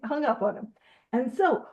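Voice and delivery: ordinary delivery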